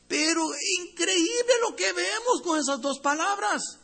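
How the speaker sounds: high, loud burst of wonder; marveling preacher. Real, not acted. Preacher marveling to the congregation, loud and high. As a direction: lift the congregation with amazement.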